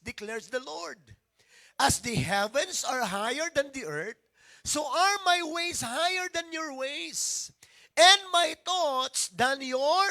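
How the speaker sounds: loud, slow, emphatic scripture reading; reading aloud loudly. Shown as a demonstration. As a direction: pastor leading congregation in reading Isaiah, loud and slow. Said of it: "Basahin natin sa malakas".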